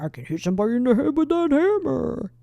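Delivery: goofy put-on cartoon voice; silly voice. Shown as a demonstration. Host repeats a troubling thought to defuse it, silly voice. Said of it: "make it a silly voice".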